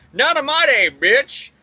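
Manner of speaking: high, hammed-up action-hero one-liner; triumphant retort, starting high and dropping; heroic taunt. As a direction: swoop in with a punchy mock battle cry. Hero bursts in, retold battle cry.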